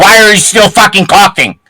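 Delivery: angry shout. Real, not acted. Heated voice chat argument.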